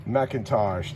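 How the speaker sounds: ordinary talk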